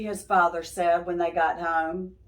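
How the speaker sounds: ordinary delivery, nothing marked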